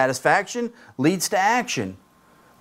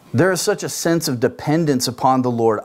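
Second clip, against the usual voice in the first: ordinary delivery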